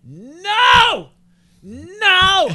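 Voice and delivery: high, loud shrieking "No!"; lady losing her mind. Retold or imitated. Comic retells a heckler shrieking no. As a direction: shriek it like an outraged audience lady. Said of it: "some lady loses her mind".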